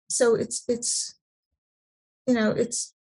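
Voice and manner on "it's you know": normal talking voice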